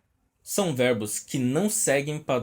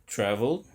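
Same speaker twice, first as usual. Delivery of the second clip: "travel" said evenly, no punch on TRA; unemphasised first syllable. Shown as a demonstration. Teacher shows a normal word without emphasis at the start, unemphasised first syllable. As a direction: keep the start of "travel" unexcited. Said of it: "quando você não se empolga pra falar no começo".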